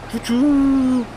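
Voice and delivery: high, loud choo-choo like a steam train; playful train horn call; train whistle imitation. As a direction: blow it out like a toy train whistle. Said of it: "that train is more like a nyong".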